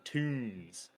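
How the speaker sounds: "tunes" said "toons", with a drawn-out oo; double-O pronunciation. Shown as a demonstration. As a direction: spell out the oo sound in "tunes". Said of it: "I'm saying, like, O O".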